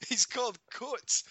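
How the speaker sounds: Coates said slowly the Scottish way; slow, deliberate Scottish Coates; Scottish accent, slow and careful; Scottish pronunciation. Shown as a demonstration. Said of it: "probably says it in his country".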